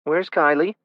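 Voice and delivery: high, panicked question. A narrator's reading. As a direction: ask as if the child is lost. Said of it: "Panic laced her voice".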